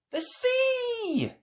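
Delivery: high excited shout. Acted. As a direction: call it out together. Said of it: "shouted all the children".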